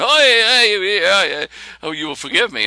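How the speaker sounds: high, loud cries of delight; overjoyed exclamation. Retold or imitated. Rabbi realizing his mistake, in a high loud burst of excitement. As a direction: burst out in amazed joy. Rabbi dancing, face red.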